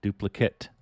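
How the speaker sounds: DUPLICATE with a short, unstressed last syllable, like "kit"; noun pronunciation. Shown as a demonstration. Streamer testing noun and verb forms, Duplicate ending in "kit". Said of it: "the noun".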